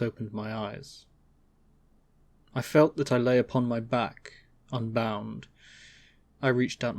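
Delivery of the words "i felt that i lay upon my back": ordinary delivery, nothing marked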